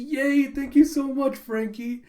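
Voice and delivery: high, loud cheer of gratitude; delighted acted thanks. Cat's owner thanks Frankie for the rescue, delighted.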